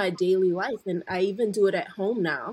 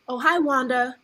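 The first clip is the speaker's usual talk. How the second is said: higher, louder phone greeting; raised, chirpy mock greeting; imitating a caller; 6 semitones higher, 6 dB louder than usual. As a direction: mimic a caller's breezy hello. Acts out cheerful caller mishearing her.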